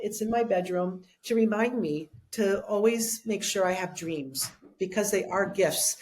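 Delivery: normal talking voice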